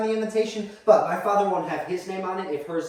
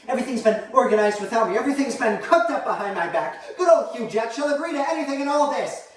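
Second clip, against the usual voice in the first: indignant complaint climbing higher; aggrieved mother; 6 semitones higher, 4 dB louder than usual. Acted. Mother to child, left out of wedding plans, aggrieved. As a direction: build the grievance up in pitch.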